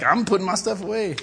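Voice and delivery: high, loud mimicry; mock the crazy guy to a coworker; mocking gossip. Mimics gossip about himself.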